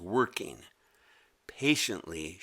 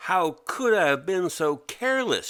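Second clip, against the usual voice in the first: self-reproach; 7 semitones higher, 5 dB louder than usual. Acted. Mimi scolds herself.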